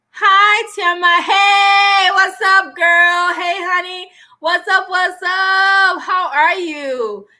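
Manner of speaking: high, loud sing-song hello; excited greeting. Real, not acted. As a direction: call out to a friend, stretching the words. Greeting a friend joining live.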